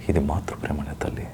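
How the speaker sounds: hushed, broken question to mother; anguished whisper. Acted. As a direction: breathe it out in pain. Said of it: "ఒక dialogue చెప్పమంటే".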